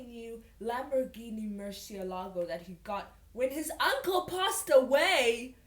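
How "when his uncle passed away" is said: high, loud, slow disbelief; over-the-top exclaiming. Acted. Reader mocking the Lamborghini inheritance, loudly exclaiming. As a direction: draw it out in hammed-up astonishment. Mocking the absurd story detail.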